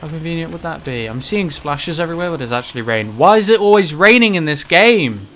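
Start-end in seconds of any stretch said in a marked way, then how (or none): 3.2-5.3 raging gamer. Real, not acted. Raging at game.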